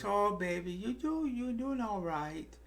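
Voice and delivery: put-on high, soft cooing baby talk. Shown as a demonstration. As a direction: coo gently like talking to a baby. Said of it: "I do my little baby voice".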